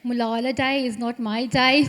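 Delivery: loud Brummie accent, laughing; Birmingham accent. Shown as a demonstration. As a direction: play the speech up in broad Brummie. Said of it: "do that speech in a Burmese accent".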